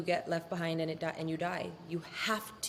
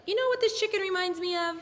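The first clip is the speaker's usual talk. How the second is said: high-pitched mock southern drawl; cheesy put-on southern drawl; 12 semitones higher, 4 dB louder than usual. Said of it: "In his southern drawl".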